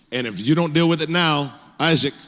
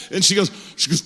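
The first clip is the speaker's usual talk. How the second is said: ordinary delivery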